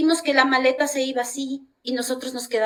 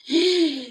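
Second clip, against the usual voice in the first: startled gasp; 6 semitones higher than usual. Retold or imitated. Realising the bus went wrong.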